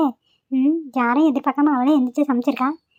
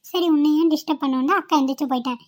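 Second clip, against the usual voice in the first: usual speaking voice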